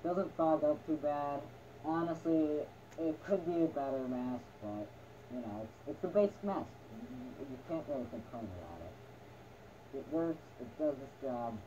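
muffled behind a rubber mask; talking with a gas mask on; through a gas mask. Real, not acted. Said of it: "you can't hear with one of these things on".

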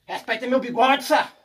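triumphant catchphrase. Real, not acted. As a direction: crow it out as the fish pulls.

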